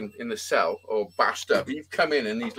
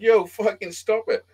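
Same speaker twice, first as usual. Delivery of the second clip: retold plea; 9 semitones higher, 3 dB louder, slower than usual. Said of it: "I'm like".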